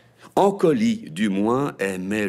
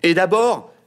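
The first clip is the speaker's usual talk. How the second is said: high, loud brat voice; loud high kid butting in; cheeky boy; 11 semitones higher, 10 dB louder than usual. A narrator's reading. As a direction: outbid your sister, loud and high. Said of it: "surenchérit Mimosa".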